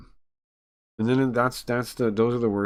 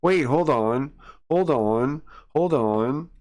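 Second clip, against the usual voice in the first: panicked; 6 semitones higher, 5 dB louder, slower than usual. Real, not acted. Panicking at the jump scare.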